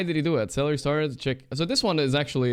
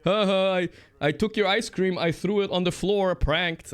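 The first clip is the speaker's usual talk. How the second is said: gloating mock prankster, pitched higher; higher, smug imitation of a prankster taunting his victim; mocking YouTube prankster; 6 semitones higher than usual. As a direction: sneer it like a smug prank-channel host. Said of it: "a YouTube prankster fucking".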